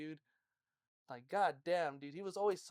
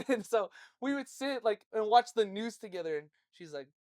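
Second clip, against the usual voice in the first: ordinary talk, nothing marked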